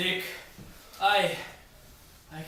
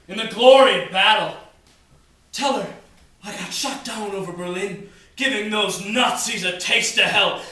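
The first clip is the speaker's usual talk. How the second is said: ordinary talk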